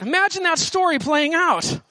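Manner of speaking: ordinary talk, nothing marked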